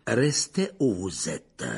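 slow, rasping calm order; a hoarse, measured command. Acted. Lupin orders the children to stay put, hoarse and slow. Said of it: "de sa voix rauque".

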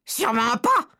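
very high, loud indignant shriek; shrieking witches. Acted. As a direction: shriek the denial. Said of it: "s'exclamèrent les autres sorcières".

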